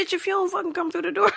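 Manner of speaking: high, rapid mock scolding; fed-up boyfriend. Boyfriend at the door months later, mock-impatient scolding. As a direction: rattle it off in comic impatience.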